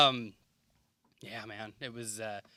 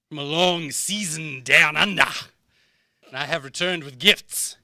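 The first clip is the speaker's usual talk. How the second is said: booming demon lord; 9 dB louder than usual. Acted. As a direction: proclaim your return like a dark lord. Piper's grand return entrance.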